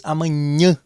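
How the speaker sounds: amanhã with ã voiced through the nose; amanhã, letting the last ã ring nasally; nasal vowel demo. Shown as a demonstration. Teacher repeating the word so students hear nasalization. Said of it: "o som acaba saindo aí pelas fossas nasais".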